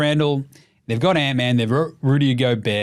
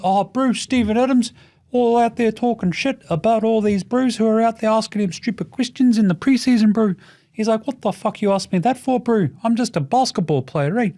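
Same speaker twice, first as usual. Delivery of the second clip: higher, put-on accent impression; teasing impression of a player's accent, pitched up; Steven Adams; 6 semitones higher than usual. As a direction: mimic the player's accent all through. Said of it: "I think I've actually nailed his accent".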